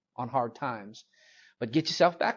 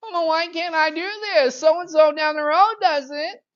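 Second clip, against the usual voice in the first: mock a kid wailing in protest; exaggerated bratty whining, pitched way up; whining child; 13 semitones higher, 8 dB louder than usual. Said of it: "they cry and they whine".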